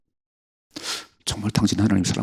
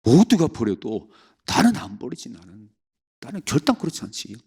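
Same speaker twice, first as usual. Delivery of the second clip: higher, cocky mock boast; mock boastful Peter; 4 semitones higher than usual. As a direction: ham up Peter's empty bragging. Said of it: "오늘 베드로 뭐라고 그러죠?". (베드로의 허세를 흉내 내는 높은 목소리)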